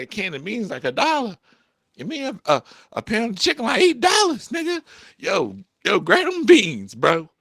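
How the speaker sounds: high, loud, incredulous haggling; animated arguing. Acted. Broke vlogger urging a bro toward beans, loudly animated. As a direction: argue the prices in high-pitched mock outrage. Acted argument for cheaper beans.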